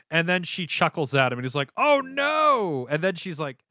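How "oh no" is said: high, drawn-out cry of dismay; exaggerated cartoon panic; mock horror. Joseph realizing she is chuckling at him, mock horror.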